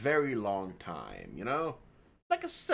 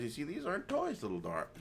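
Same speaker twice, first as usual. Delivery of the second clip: put-on softer, quieter, talking down to a child; gentle aside to niece; 7 semitones lower, 7 dB quieter than usual. Uncle showing his niece the gun display, gently. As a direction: explain kindly to a little girl.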